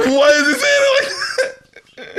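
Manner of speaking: high squealing laugh through the words; laughing disbelief. Real, not acted. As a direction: crack up while asking.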